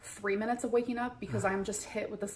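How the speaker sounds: ordinary talk, nothing marked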